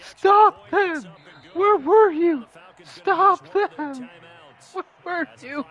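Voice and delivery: high, anguished coach outburst; hammed-up coach losing it at the team; coach having a breakdown. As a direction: plead and yell like a devastated coach. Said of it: "The coach comes in, and he's just like".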